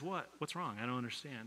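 soft, worried hush of a mother; hushed worried mom. Retold or imitated. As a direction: lean in and ask gently, barely voiced. Mom asks crying son what's wrong.

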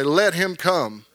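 slow, drawn-out lead line for the church to repeat; slow call and response. Shown as a demonstration. Preacher leads congregation to repeat the verse, slow and drawn out. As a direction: lead it slowly so all can echo. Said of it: "Everybody say it".

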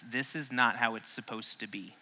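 a muttered inner complaint; quiet internal grumble. Pretend. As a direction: say it under your breath. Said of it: "the internal dialogue you are probably having is".

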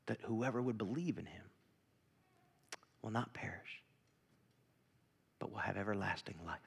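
quiet reverent recitation; hushed scripture reading. Acted. Reads John 3:16 softly.

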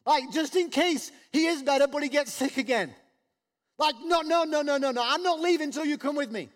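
high and loud, frantic refusal to leave; panicky insistence. Retold or imitated. Imagined father refusing to leave without Jesus, frantic. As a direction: play the frightened father who won't go. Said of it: "which wouldn't be out of the question".

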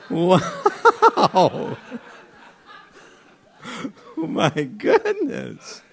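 high, real laughter through the words; helpless amusement, words broken by laughs; laughing. Real, not acted. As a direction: react while cracking up. Amused reaction, laughing as they speak.

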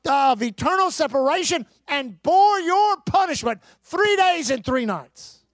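preaching at full force. Acted. Pastor warning his congregation, preaching at full force. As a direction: preach it with urgency.

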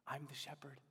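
soft whisper, almost unvoiced; hushed whisper of Jesus. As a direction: breathe the words like a secret.

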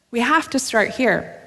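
ordinary talk

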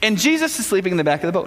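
ordinary talk, nothing marked

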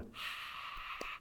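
breathy, voiceless "ah" of a distant crowd; soft, airy crowd noise; hushed crowd cheer. Acted. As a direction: imitate a restrained crowd roar under your breath. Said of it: "that's a golf clap".

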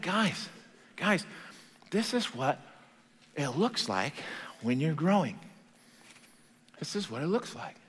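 soft, measured pleading with pauses; quiet, intimate acted address; earnest hushed appeal. Preacher voicing Peter's earnest letter.

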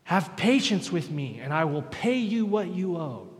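slow, high, loud begging; pleading debtor. Acted. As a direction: plead for mercy, drawn out. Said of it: "the exact same thing this guy said".

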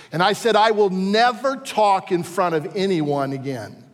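high, loud preaching that breaks into a laugh; full-force preaching. Acted. Preacher recalls vowing silence.

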